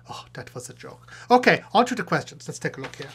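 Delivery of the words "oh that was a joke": breathy stage whisper aside. Acted. Puppet whispering an aside to his viewers. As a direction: lean in and whisper it to the audience. Aside to audience after joke.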